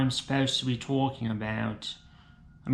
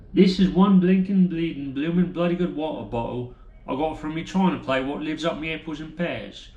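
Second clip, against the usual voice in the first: Cockney geezer. Acted. Comic playing a Cockney bloke bragging about his water bottle, Cockney accent. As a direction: lay on the Cockney rhyming slang.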